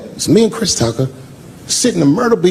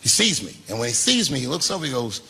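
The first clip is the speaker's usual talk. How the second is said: hushed storytelling; 9 dB quieter than usual. Acted. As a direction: draw the crowd in, almost murmuring.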